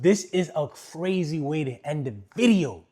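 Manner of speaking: loud, emphatic amazement; excited. Real, not acted. Excited, first of two takes.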